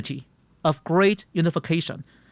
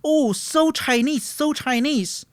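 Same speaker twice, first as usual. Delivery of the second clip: retold excited shout; 9 semitones higher than usual. Classmates marvelling at old musicians in Beijing, retold mock shout. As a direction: imitate classmates marvelling in a high voice. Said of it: "and they shouted".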